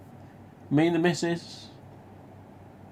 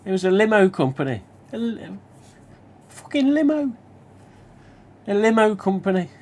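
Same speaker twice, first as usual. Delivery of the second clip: high, fast, incredulous retelling; can't believe it's a limo company; excited disbelief; 7 semitones higher than usual. Retold or imitated. Telling a friend about the surprise call, excited and incredulous.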